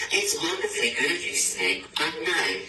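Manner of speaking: very high, fast and bright; sing-song refusal. Acted. Mouse politely turning down the snake, very high and quick. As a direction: trill it off quickly as the cheeky mouse.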